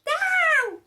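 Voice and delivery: cried out. Acted. Witch crying out to land again, high call. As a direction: call the broom down. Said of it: "cried the witch".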